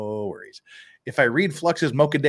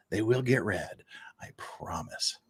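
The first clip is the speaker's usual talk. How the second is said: whispering; 3 semitones lower, 7 dB quieter, slower than usual. Acted. Whispered promise to chat.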